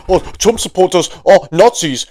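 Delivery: mocking posh British accent; Mehdi Hasan posh accent. Mocking the host on Piers Morgan, posh British accent. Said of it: "with his posh little accent".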